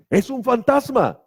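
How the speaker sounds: a high, slow scared cry; frightened cry. Acted. Disciples on the lake see a ghost, frightened high cry. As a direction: cry out as if you saw a ghost. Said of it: "gritaron de miedo".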